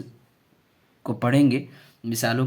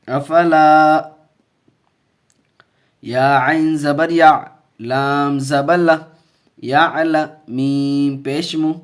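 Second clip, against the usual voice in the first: loud, slow syllable-by-syllable chanting of the letters; drawn-out classroom recitation; spelling-drill recitation; 3 semitones higher, 8 dB louder, much slower than usual. Shown as a demonstration. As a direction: call each letter and vowel out for the class to repeat. Long spelling drill of words.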